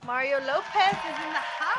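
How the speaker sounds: loud hype announcement; announcer shout. Real, not acted. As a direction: call it out like an MC. Hypes guest arrival, announcer call.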